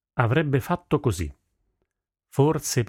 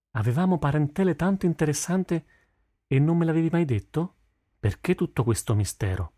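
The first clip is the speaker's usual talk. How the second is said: high-pitched, excited reproach; astonished daughter; 7 semitones higher than usual. Acted. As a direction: question your father in amazement. Daughter astonished at family secret. (figlia stupita)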